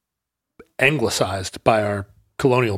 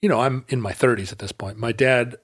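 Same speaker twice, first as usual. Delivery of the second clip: usual speaking voice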